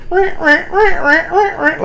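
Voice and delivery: high, silly rah rah grunting chant; mock effort chant. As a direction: chant rah rah like you're straining through reps. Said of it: "just like".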